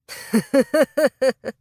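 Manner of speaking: amused mock-hearty laugh; acted laughter. A narrator's reading. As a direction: laugh along with the men. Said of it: "Отсмеявшись вместе с подчинёнными отца".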